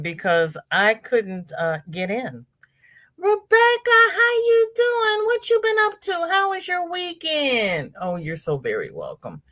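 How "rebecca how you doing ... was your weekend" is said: singsong greeting. Real, not acted. Host welcoming Rebecca into the live chat, high singsong. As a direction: greet her in a high sustained lilt.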